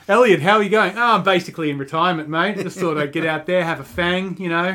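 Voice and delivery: hammed-up Australian accent for a Kenyan runner; cheeky Australian accent, pub-mate style; bad Australian Kipchoge. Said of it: "a chilling impersonation of Kipchoge".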